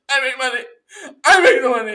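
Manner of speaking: very high, loud strained tantrum; hammed-up enraged shrieking; mad screaming. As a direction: scream it like a furious millionaire losing it. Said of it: "he's so mad".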